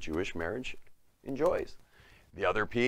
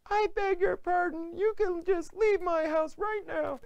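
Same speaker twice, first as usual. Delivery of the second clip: very high, slow indignant falsetto; mock angry woman; 3 dB louder than usual. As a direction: throw them out in a hammed-up outrage. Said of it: "she was very angry with us".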